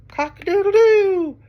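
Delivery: loud, very high crow; rooster crow. Acted. Reader reviewing the rooster with children, loud crowing. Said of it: "He can sound like a rooster".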